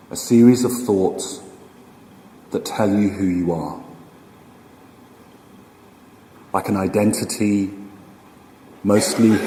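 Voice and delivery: slow, unhurried meditation guide with long pauses; guided meditation. Acted. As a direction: ease them into stillness, phrase by phrase, leaving room to breathe.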